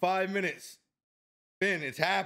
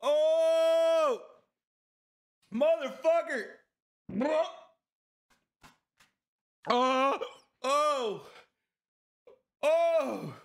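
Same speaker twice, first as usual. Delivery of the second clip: pained yells; 10 semitones higher, much slower than usual. Real, not acted. Eating the hot gummy bear, yelling in real pain. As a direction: yell out as the heat hits. Burning mouth from hot candy.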